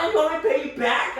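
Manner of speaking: high, loud snippy mock; huffy sister. Sister to narrator over the flight money, high snippy mock. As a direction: sneer it like an annoyed sister. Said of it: "She's like".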